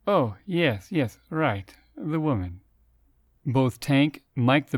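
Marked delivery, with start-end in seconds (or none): 0.1-2.6 tipsy, slurred agreement; slurring drunk. Acted. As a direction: slur it like a drunk. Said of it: "while slurring his words".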